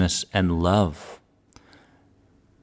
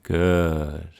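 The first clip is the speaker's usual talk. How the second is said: calm grounding exercise. Acted. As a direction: calmly guide each feeling, pausing long. Guides a feeling exercise.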